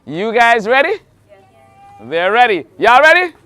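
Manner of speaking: big hyped announcer voice; game show host. Acted. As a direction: sell it like a TV host. Hyping contestants to start.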